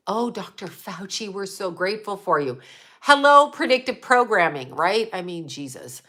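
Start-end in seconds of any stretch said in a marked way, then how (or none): 0.1-2.6 softer, airy mock gratitude; hushed sarcastic parody; mocking Julia Roberts. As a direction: coo it like a devoted fan. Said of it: "she's like".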